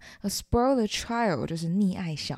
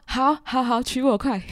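higher, louder, blurting acceptance; eager instant yes; 5 semitones higher, 5 dB louder than usual. Acted. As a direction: jump in before the question is finished. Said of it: "我就立马说". (迫不及待地抢着答应)

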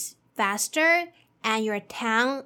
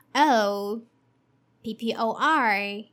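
ordinary talk, nothing marked

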